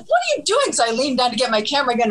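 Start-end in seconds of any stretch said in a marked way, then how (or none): 0.0-0.7 high startled squeal of disbelief; alarmed friend. Retold or imitated. Friend in the driver's seat baffled by the camera, high alarmed squeak. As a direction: imitate a baffled friend shrieking at you. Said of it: "and Debbie's like".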